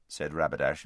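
plain reading voice

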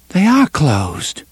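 high, slow, lightly peevish; a touch of petulance; a trifle pettish. Acted. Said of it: "a trifle pettishly".

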